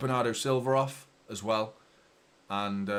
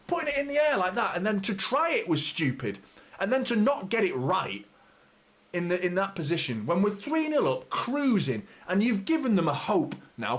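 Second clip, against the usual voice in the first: angry rant; 5 semitones higher than usual. Real, not acted. Fan raging at his screen over a careless back pass. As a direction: tear into the defender's mistake.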